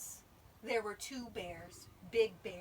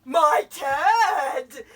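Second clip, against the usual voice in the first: very loud, higher astonished cry; gasped; 6 semitones higher, 22 dB louder than usual. A narrator's reading. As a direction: gasp it out as the startled bear. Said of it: "gasped the bear".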